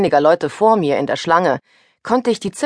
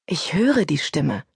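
usual speaking voice

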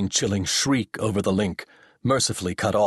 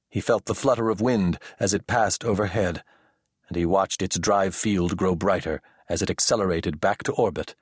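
usual speaking voice

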